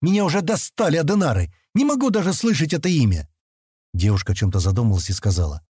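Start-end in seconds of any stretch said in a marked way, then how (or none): 0.0-3.3 fed up, pitched well up; an acted exasperated outburst, higher voice. A narrator's reading. Stan complaining to the girl about a name, exasperated.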